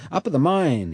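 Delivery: a hint of a Cockney working man, chatty and sure; lightly voiced Cockney boatman. A narrator's reading. Allnut reassures Rose about the German boat, light Cockney voice. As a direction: talk it through like a knowing bargeman, light Cockney touch. Allnut tells mine story.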